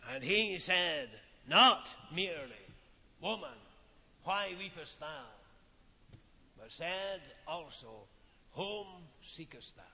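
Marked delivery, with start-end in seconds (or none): 3.2-5.2 tender gracious question. Acted. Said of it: "into whose lips grace was poured". 8.5-9.8 softly, the risen Lord asks; soft and tender, barely above a murmur; gentle, grace-filled question. Acted. Jesus gently asks the weeping Mary, softly. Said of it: "who had words of grace".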